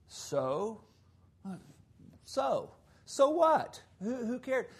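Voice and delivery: mock dismissive "So what?", pitched high and scornful. Preacher mocking a careless "so what" to the church. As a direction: toss it off like nobody cares. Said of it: "you can use that word a lot of different ways".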